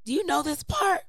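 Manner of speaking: high, bright coaxing voice; high excited voice. Retold or imitated. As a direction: coax her up, high and eager. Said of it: "She was like".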